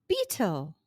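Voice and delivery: plain reading voice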